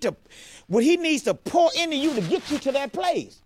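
rising sermon push; preaching at full force. Acted. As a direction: build the point like a pulpit climb.

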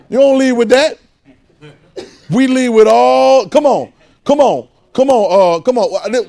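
loud, high, slow come on; laughing, then calling out loud and high; rousing call. Acted. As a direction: urge the crowd, stretching each come on. Preacher rousing crowd.

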